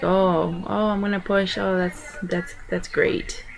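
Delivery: soft, listless, worn-out mock reaction; drained zombie-like mumble. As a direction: act too exhausted to care, very softly. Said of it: "a zombie. Like, I was just laying there".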